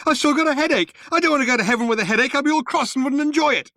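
high, hurried frantic yelling; yelled. A narrator's reading. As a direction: wail out objections at speed. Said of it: "he yelled".

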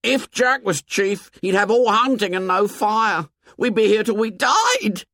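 put-on very high, panicky boy; high-pitched, frightened outburst; rising to a squeak. A narrator's reading. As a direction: squeak it out in alarm. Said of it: "His voice ran up to a squeak".

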